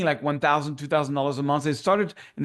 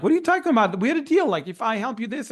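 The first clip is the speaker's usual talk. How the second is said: much higher, indignant retold protest; 9 semitones higher than usual. Producer arguing with partners over a broken deal, high indignant protest.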